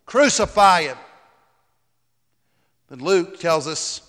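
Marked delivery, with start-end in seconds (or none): 0.1-1.0 raised, louder mob demand; insistent crowd cry. A narrator's reading. Crowd insists to Pilate, crying out higher and louder. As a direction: cry out all the more. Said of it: "they cried out all the more".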